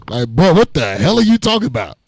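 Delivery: ordinary delivery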